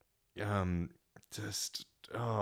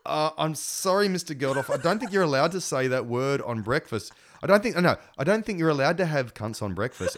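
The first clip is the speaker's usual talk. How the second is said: higher-pitched, prim apologetic objection; flustered, apologetic reprimand in a raised voice; mock apologetic telling-off; 6 semitones higher, 5 dB louder than usual. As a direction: protest politely, pitched up. Acting own flustered scolding of a guest.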